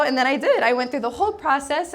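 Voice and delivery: ordinary talk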